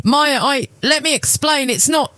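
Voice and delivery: high, nervous pleading; panicked husband. A narrator's reading. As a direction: scramble for an excuse.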